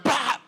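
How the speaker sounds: high loud mock pop; cartoon "Bah!" pop; mustache popping off. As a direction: burst the sound out like a mustache flying off. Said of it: "the mustache would be like".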